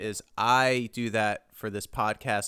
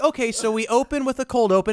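TV exec voice; 10 semitones higher, 5 dB louder than usual. Pretend. Acting TV execs in pitch room.

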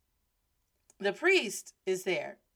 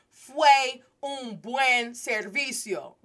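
slow, louder, word-by-word Spanish practice; careful rehearsal; 3 semitones higher, 5 dB louder, much slower than usual. Retold or imitated. Student rehearsing her sentence for the priest, slow and deliberate. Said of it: "I know I wanna say".

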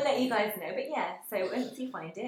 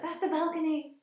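excited; 9 semitones higher, 4 dB louder, slower than usual. Retold or imitated.

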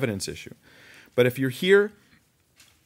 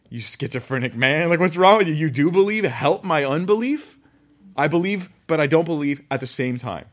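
incredulous mockery; 4 semitones higher, 5 dB louder than usual. Real, not acted. Mocking the father's contradiction.

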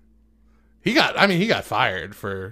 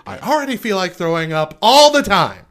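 loud, high-pitched comic tirade; worked-up, punchy complaint; exasperated rant; 4 semitones higher, 8 dB louder than usual. Real, not acted. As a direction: rant it out at full volume. Exasperated comic rant on roller coasters.

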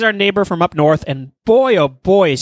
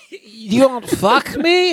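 put-on much higher, very slow falsetto Russian accent; mock Russian woman; 9 semitones higher, much slower than usual. Repeating the joke line, in a high slow mock accent. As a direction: squeak it out like a parody Russian seductress. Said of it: "hard to do a female Russian accent".

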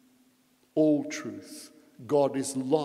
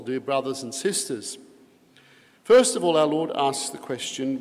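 normal talking voice

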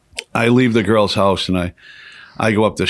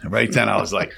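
normal talking voice

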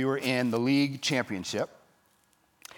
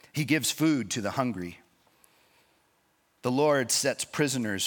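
scripture reading; 4 semitones lower than usual. Acted. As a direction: read the psalm with reverent weight. Reads Psalm 146 aloud.

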